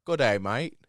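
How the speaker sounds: mock Australian accent. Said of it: "We can't fucking do the accent".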